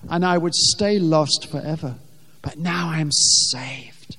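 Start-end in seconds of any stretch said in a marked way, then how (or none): 2.4-4.2 slow, breathy whisper of relief; hushed whisper. Real, not acted. Hushed contrast after loud line.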